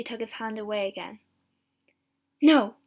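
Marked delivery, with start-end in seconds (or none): 2.4-2.8 loud, higher refusal; horrified thought. A narrator's reading. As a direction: recoil from the idea. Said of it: "he thought".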